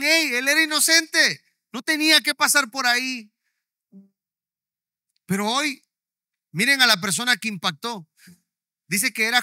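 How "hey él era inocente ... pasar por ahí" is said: high, loud crowd cry; crowd shouting. Retold or imitated. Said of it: "Todos empezaron a gritar".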